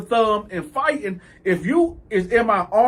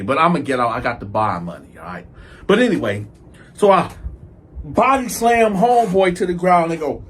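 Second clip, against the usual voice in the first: usual speaking voice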